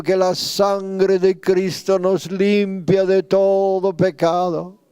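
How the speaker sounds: impassioned prayer, pitch climbing as it builds; a pastor pleading aloud for revival, slow and rising; fervent preaching prayer. Acted. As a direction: cry out to God with growing urgency, drawing out the pleas.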